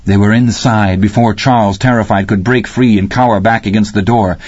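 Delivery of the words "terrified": plain reading voice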